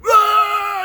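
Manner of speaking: long, high, loud scream; imitated scream. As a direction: scream out like a bloodied fighter. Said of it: "He's screaming".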